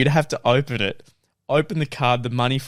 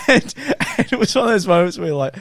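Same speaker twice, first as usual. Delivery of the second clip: ordinary delivery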